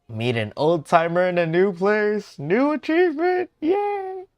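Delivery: loud, climbing to a high squeal; excited cheer. Real, not acted. Streamer unlocking a new achievement, loud excited cheer.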